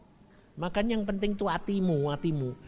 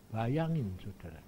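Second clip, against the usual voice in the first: normal talking voice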